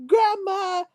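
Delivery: a mock yell lost in laughter; half-shouted through laughter; laughing shout. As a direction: blurt it out cracking up. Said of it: "he gonna be like".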